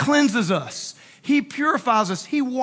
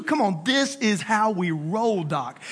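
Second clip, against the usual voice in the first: slow, low, laid-back swagger; cool swagger; 4 semitones lower than usual. Acted. Preacher playing Jesus rolling through town, cool swagger. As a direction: play it cool, dropping low at the end.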